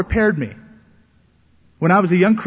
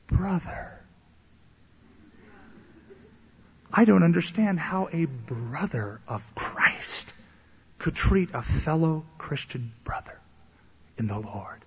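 guilt-tripping plea; 5 dB quieter, slower than usual. Retold or imitated. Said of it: "pouring on the guilt".

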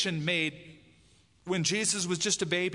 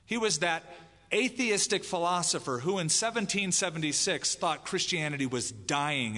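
ordinary talk

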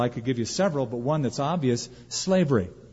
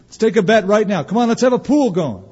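rising into a loud, excited bookie's pitch; hammed-up heavenly gambler getting louder; mock betting angels; 4 semitones higher, 5 dB louder than usual. Preacher voicing angels placing bets, mock excited. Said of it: "God isn't saying".

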